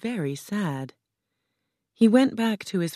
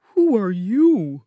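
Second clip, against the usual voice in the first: slow, louder, lightly voiced surprised Scarecrow; a startled question, drawn out; in surprise; 4 semitones higher, 5 dB louder, slower than usual. A narrator's reading. Scarecrow sees a winged woman, mildly startled. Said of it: "the Scarecrow asked in surprise".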